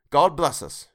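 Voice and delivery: a father's toast, hint of a lift; lightly voiced father toasting; Bob's toast. A narrator's reading. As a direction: raise a glass gently to the family. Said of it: "Then Bob proposed".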